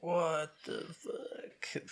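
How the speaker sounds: slow, breathless whisper of disbelief; a hushed, drawn-out what the fuck; stunned whisper. Real, not acted. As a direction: mouth it under your breath, slowly. Disbelief at using the elevator.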